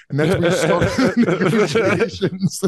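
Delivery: words breaking into real laughter; laughing. Real, not acted. Teasing a friend over his bad negotiating, laughing. Amused by own retold story.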